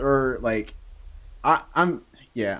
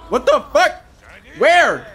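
panicking gamer; 10 semitones higher, 4 dB louder than usual. Real, not acted. Sudden enemy appears, panicked.

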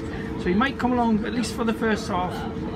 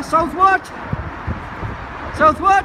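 loud, high call-and-response prompt; rallying call; 7 semitones higher, 4 dB louder than usual. Real, not acted. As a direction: call it out for others to finish. Prompting others to call the answer.

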